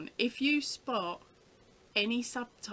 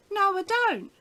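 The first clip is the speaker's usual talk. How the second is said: high, loud retold denial; insistent refusal; 11 semitones higher, 9 dB louder than usual. Mum refusing a window salesman, acted stubborn and high. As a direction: dig in, imitating a stubborn customer.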